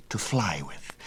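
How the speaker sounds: a breathy whisper of the idea. Acted. Son confides his plan, whispered. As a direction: whisper it like a secret. Icarus hushed aside.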